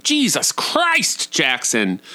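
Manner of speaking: high, drawn-out exasperation, dropping on the name; exasperated yelling. Retold or imitated. Parent getting loud with his toddler, imitated exasperation. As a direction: imitate a fed-up parent's outburst. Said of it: "when we do get loud with him".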